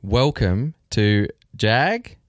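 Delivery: ordinary talk, nothing marked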